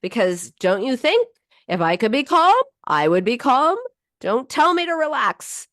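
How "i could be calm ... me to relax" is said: higher, exasperated retort; mock irritated. Upset person retorting to someone telling them to relax, exasperated. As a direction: snap back in pretend annoyance. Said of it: "it's so enraging".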